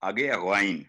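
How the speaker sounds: ordinary talk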